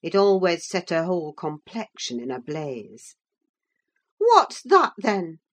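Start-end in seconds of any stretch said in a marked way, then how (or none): 4.2-5.4 high, loud challenge; pointed comeback starting high and loud; sharp retort. A narrator's reading. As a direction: throw it back as proof she is lying. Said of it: "I retorted".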